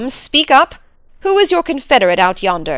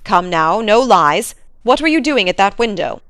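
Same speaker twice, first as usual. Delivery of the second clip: master pressing for truth; stern interrogation, building; stern Sir Henry. Acted. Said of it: "said Sir Henry sternly".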